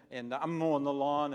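ordinary talk

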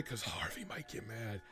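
hushed aside whisper. Acted. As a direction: whisper it behind your hand.

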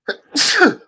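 loud sudden sneeze; a real sneeze, high and sharp; sneezing. Real, not acted. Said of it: "Excuse me, I have to sneeze".

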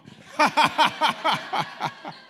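very high, loud staccato ha-ha-ha; huge mocking laugh at the lie; big fake laugh. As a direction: laugh the lie down with everything you have. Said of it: "give a really big laugh on this one".

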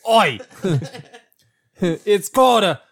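hammed-up bad Australian, drawn out; horrible Australian accent. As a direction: ham up a terrible Aussie accent through laughter. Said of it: "That's a horrible Australian accent".

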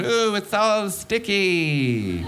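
very slow, dazed, high; drawn-out stoned wonder; stoned friend. Retold or imitated. Stoned friend staring at ice cream, slow dazed voice. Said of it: "he was just going".

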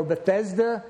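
"Bethesda" said beth-EZ-duh; alternate pronunciation. Shown as a demonstration. Preacher offering another name for the pool, pronouncing "Bethesda" as beth-EZ-duh. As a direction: say "Bethesda" with a short EH and a Z. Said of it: "different ways to pronounce that".

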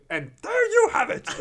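very high, loud puppet voice; hammed-up muppet announcing to the camera; Grover. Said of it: "that was a good impression".

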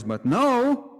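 high and loud, jumping up on no; sudden loud emphatic no. Acted. Emphatic rejection in sermon.

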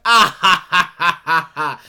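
loud, high imitated killer cackle; hammed-up madman laughing in bursts; mock maniacal laugh. Film fan imitates the killer driving off, mock maniacal laugh.

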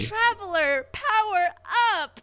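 high, bright transformation cry; bright magical girl. Retold or imitated. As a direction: shout it like an anime heroine. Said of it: "a magical girl transformation".

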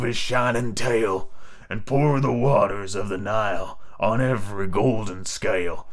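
hoarse and strange. A narrator's reading. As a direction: recite it as if the voice isn't your own. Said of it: "her voice sounded hoarse and strange".

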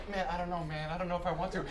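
hesitant mumble. Retold or imitated. Friends guy hesitating, soft and unsure. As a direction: hold back, shy and unsure. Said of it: "he's like".

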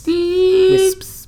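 Wisps said in a sudden very high squeak. Shown as a demonstration.

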